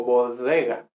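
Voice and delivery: "bodega" with a drawn-out fricative D; sustained soft d. Shown as a demonstration. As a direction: hold the D in "bodega" as a long, soft continuous sound. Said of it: "puedo mantenerla en el tiempo".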